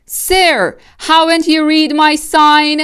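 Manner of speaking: little mouse dentist calling up firmly. Acted. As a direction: call down to the fox from the window. Dentist refusing the fox.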